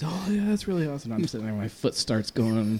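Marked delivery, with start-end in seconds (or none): 0.0-1.1 mock impressed crowd. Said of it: "So everybody's".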